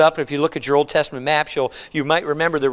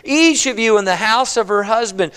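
fervent blessing; 7 semitones higher than usual. Acted. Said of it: "she's talking".

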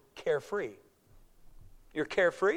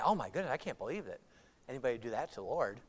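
mock shock; 6 semitones lower, 8 dB quieter, faster than usual. Mocking pious listeners' reaction.

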